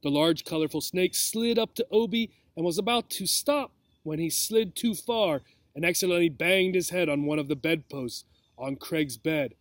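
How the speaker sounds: ordinary delivery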